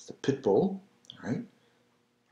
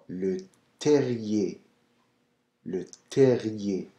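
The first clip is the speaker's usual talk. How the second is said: usual speaking voice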